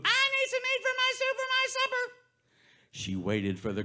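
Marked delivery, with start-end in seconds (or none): 0.0-2.1 put-on old woman calling. Old lady calling to the grocer, very high voice. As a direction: call through the door, very high.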